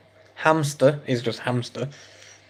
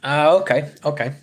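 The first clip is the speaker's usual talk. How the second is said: ordinary delivery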